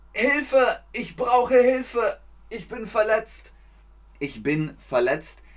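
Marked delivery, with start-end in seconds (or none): 0.1-3.5 high, loud pleading for help; mock cry for help. Teacher acts a hurt caller crying for help. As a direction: wail it like someone hurt.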